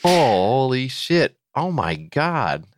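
slow awed disbelief; stunned. Real, not acted. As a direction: let it sink in slowly. Stunned by the pricey reward.

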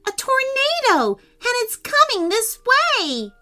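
high-pitched, urgent warning; urgent alarm. A narrator's reading. Prince warning the others of the tornado, urgent alarm. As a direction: sound the alarm as the storybook prince. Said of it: "said Prince Henry urgently".

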